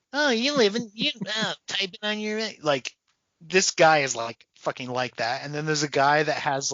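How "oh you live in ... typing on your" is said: high mocking voice imitating a basement dweller; lightly voiced mocking nerd. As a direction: sneer at the internet guy, pitched up. Mocking internet neckbeard guy.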